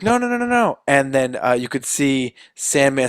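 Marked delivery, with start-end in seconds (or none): 0.0-0.8 much higher rapid string of nos; panicked refusal, pitched way up; frantic denial. Retold or imitated. Spider-Man pleading with Sandman, frantic and high. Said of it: "he's like".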